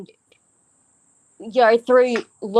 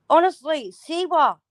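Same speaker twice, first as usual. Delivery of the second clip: higher pleading fed-up jab; exasperated; 7 semitones higher than usual. Real, not acted. Exasperated at [name].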